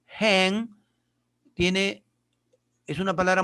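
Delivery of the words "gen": Gen said as hen with a short Spanish e; Gen, short e shut between two consonants; English hen. Shown as a demonstration. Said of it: "que significa gallina".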